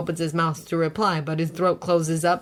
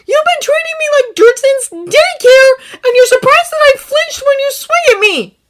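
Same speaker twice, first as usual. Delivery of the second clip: much higher, louder shouted reproach; tearful shout; 14 dB louder than usual. Acted. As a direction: shout the hurt out. Izuku's tearful counterattack.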